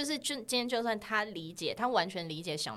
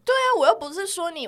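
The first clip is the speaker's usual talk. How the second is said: emphatic; 10 semitones higher, 9 dB louder than usual. Real, not acted. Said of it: "我又不是说你白吃白喝".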